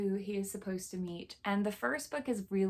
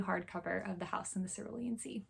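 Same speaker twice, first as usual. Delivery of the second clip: soft unvoiced whisper, almost to herself; whispered aside; 6 dB quieter than usual. Real, not acted. Showing her new book to viewers, whispered aside. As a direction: breathe it out as a little secret.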